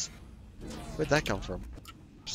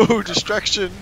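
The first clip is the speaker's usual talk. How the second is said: mock excitement; 10 semitones higher than usual. Mock cheer.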